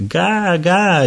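put-on high playful child's echo; little girl echoing. A narrator's reading. Kate casting her spell while echoing, high voice. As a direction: mimic the baby back. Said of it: "gli fece eco Kate".

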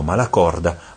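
ordinary delivery, nothing marked

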